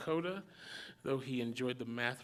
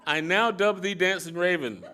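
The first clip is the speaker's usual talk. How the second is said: louder, higher mock solemn decree; mock ceremonial dubbing; 7 semitones higher, 9 dB louder than usual. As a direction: proclaim it grandly, then snap with a laugh. Jesus mock-dubs Elihu.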